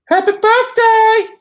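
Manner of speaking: very high, drawn-out loud squeal; squeaky birthday cheer. Pretend. Wishing Sam Rogers happy birthday, high squeal. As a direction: squeal it in a silly high put-on voice.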